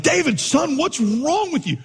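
high-pitched mock scolding for onlookers; showy scolding. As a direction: act out a coach's put-on exasperation. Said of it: "I'm doing this for the parents".

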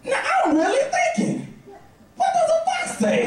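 very high, squeaky comic pitch; put-on high voice. Uncle and niece puzzling over the fox, very high-pitched. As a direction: push the voice way up for the exchange.

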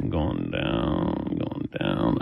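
very low, soft, fading voice; mock fainting away. Host re-enacts collapsing on the kitchen floor, fading low. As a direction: sink far down in pitch as if passing out. Acting out his own faint.

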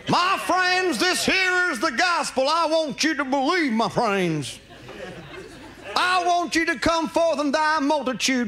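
slow, booming preacher impression; Jimmy Swaggart preaching. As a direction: preach like a TV evangelist. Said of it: "Sunday Morning with Jimmy Swaggart".

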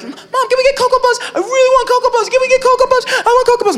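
put-on high whiny little kid, pleading for cereal; begging kid. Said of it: "my brother would be like".

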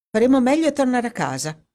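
brisk and breezy, pitched high; quick, offhand suggestion, as if nothing mattered; casual. A narrator's reading. Dorothy suggests to Edward going home, casual. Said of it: "disse in tono disinvolto".

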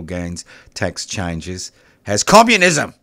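communism dragged out slowly; mock horror, slow and loud; mocking emphasis.

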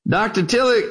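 a hint of an old country preacher speaking up. Old preacher standing to question the scholar, lightly voiced.

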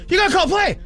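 high-pitched squawk imitating screaming at the TV; mock screaming. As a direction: yell it in a very high voice, like an exasperated viewer. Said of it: "He's just, like, screaming at the T V".

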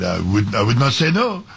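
put-on a light, invented French accent; made-up French accent. Host mimicking the French player in a mild French accent. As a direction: toss off a half-hearted French accent. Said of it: "I'm inventing the accent".

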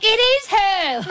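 high, slow squeal of mock recognition; imitating strangers shouting as her car drives off; excited realisation. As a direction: call out like you just spotted a celebrity. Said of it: "they're like".

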